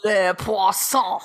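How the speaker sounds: slow, hammed-up French chef voice saying Le Poisson; French chef impression. Co-host imitating the cartoon French chef, slow and hammed-up. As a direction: draw out Le Poisson like the Little Mermaid chef. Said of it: "I can do that one".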